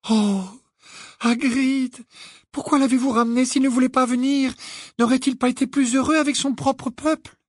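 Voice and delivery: high, distressed reproach; aghast. A narrator's reading. Hermione reproaches Hagrid for bringing the giant, aghast. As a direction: reproach him in a high, appalled voice. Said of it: "dit Hermione effarée".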